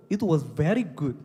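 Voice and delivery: "very" stretched out very slowly and stressed, falling; a slow, heavy "very" with a falling tune. Shown as a demonstration. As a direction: drag out "very" and land it hard. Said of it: "그냥 굿도 아니고".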